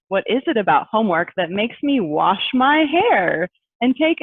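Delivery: lively, expressive poem reading. Acted. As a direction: read it with playful rising questions. Poem read aloud.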